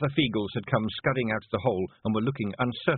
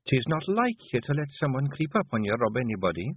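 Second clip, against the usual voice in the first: higher, gently chiding Scots wife; Scottish wife; 5 semitones higher than usual. Acted. Wife creeping up on her brooding husband, gently chiding. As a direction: speak as a concerned Scottish wife. Voices Jeanie.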